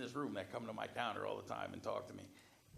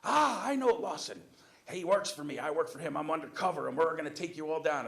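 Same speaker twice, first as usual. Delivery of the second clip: loud, raised-pitch imitated tirade; screaming rant; 6 semitones higher, 11 dB louder than usual. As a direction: holler threats like an irate customer. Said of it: "starts screaming, yelling".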